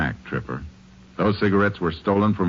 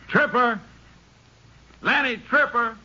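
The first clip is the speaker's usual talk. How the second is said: calling out. Acted. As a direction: call out high across the rocks to the mine. Calling into the mine.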